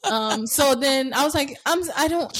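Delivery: ordinary delivery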